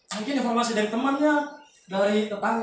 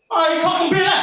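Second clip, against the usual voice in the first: loud, high imitation of an outspoken man; vocal man's outburst; 8 semitones higher, 5 dB louder than usual. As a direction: call it out loud and blunt, imitating Pak Lazarus. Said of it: "Pak Lazarus itu orang sangat vokal".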